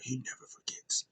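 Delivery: hushed, ominous whisper; serious whisper. Retold or imitated. Host turning real serious after forgiving, ominous whisper. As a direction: whisper it like a grave warning. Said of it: "he just gets real serious".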